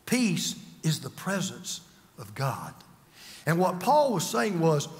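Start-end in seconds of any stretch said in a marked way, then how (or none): 0.1-2.8 low, slow and soft; hushed reassurance. Real, not acted. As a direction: let the voice sink gently as you reassure. Preacher softens on the comforting point.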